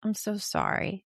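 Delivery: soft, tender sympathy; empathizing parent. Shown as a demonstration. Parent comforts crying child, softly sympathetic. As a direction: soothe quietly, calm and supportive. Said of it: "And empathizing".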